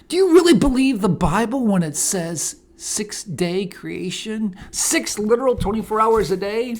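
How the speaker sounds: lightly acted challenge to a witness; Darrow cross-examining. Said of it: "did Darrow put him in a pretzel".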